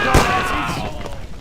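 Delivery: angry yell. Acted. Boss yells at his men.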